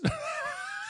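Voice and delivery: sharp high-pitched burst of laughter. Real, not acted. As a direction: laugh at your own bit. Laughs at own impression.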